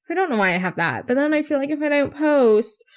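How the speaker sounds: ordinary talk, nothing marked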